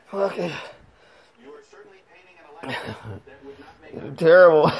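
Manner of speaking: strained breathless. Real, not acted. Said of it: "the pressure's so strong".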